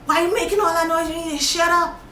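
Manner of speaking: hammed-up nurse snapping at her to hush; nurse scolding. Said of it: "then the woman telling me".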